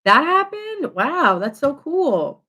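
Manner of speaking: high, loud, amazed "really?"; impressed gush. Retold or imitated. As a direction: gush with wide-eyed wonder, stretching "cool". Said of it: "and you're like".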